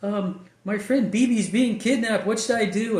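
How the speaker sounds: put-on high, scared young girl on the phone; panicked daughter. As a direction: squeak it out like a frightened teen calling her dad. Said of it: "She goes".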